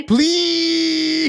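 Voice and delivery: long, high, loud begging wail; mock pleading. Co-host imitating the pushy caller begging, mock pleading. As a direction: drag it out as an exaggerated plea. Imitating the man's desperate plea.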